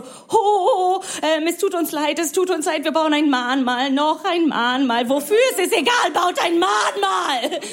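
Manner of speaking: high, wailing mock lament; hammed-up remorse, building to a desperate high cry; mock German remorse. Germans atoning for the war, hammed-up high wailing. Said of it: "ihr habt so Erinnerungskultur, ihr seid so".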